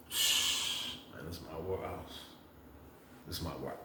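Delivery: whispered aside telling the lady off; hushed whisper. Retold or imitated. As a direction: breathe it like a secret warning. Him hushing, claiming his bunk.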